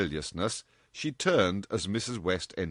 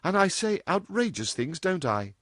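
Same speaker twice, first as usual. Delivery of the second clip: put-on a higher, playful young woman; lightly voiced cheeky girl; 10 semitones higher than usual. A narrator's reading. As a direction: tease her mother lightly. Dorothy voiced in story.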